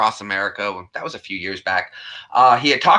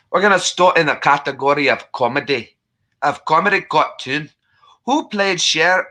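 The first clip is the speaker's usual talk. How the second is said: hearty, higher-pitched Scottish brogue; Scottish accent; 3 semitones higher than usual. Acted. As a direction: play the Scottish game host. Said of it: "I've got to talk like I'm Scottish".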